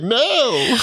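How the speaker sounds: very high, comic cry of refusal; horrified refusal. Retold or imitated. Recalling an unwanted sales promise, mock-horrified refusal. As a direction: refuse in high, exaggerated dismay.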